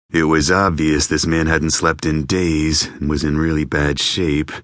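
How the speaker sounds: plain reading voice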